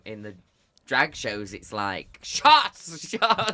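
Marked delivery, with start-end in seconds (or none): 2.2-3.5 high, loud mock cheer with a laugh; rowdy party call. Imitating the drag show green room, high and rowdy. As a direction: call for drinks like a reveller.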